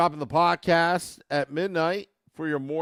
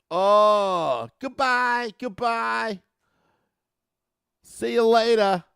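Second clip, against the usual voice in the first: higher, louder New York "goodbye, goodbye"; New York accent; 6 semitones higher, 6 dB louder, slower than usual. Retold or imitated. Taunting the beaten Rangers in a New York accent. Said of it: "that New York accent".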